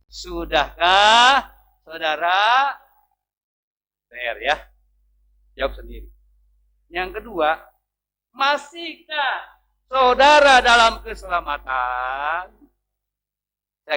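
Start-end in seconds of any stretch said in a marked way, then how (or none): 0.1-2.7 drawn out slowly, weighty; slow searching question to the congregation; slow probing question. Acted. Preacher drawing out a question. 8.3-12.6 higher, louder, very slow; slow piercing question to the church; slow weighty question. Acted. Preacher drawing out a question.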